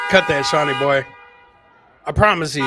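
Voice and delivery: normal talking voice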